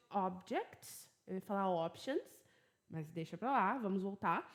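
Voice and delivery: ordinary talk, nothing marked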